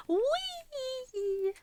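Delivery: high, cutesy little squeal of comfort; playful squeal. Pretend. Showing viewers her comfy PJ model, a playful squeal. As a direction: squeal like a happy kid rolling in pajamas. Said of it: "look at how comfortable I am".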